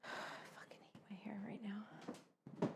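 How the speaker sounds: breathy whispered grumble at her own hair; whispered frustration. Real, not acted. To herself while fighting her hair, a frustrated whisper. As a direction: mutter it under your breath, annoyed.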